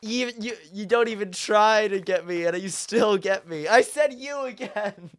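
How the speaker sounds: high-pitched, loud complaint at being caught; helpless, worked-up protest to the chat; exasperated protest. Real, not acted.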